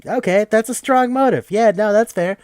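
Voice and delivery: real excitement breaking into the explanation; excited. Real, not acted. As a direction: get suddenly fired up. Suddenly fired up about motive.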